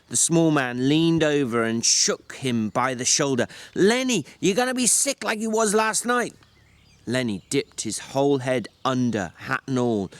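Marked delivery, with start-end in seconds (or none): 3.7-6.3 scolding. A narrator's reading. George shakes Lenny, scolding.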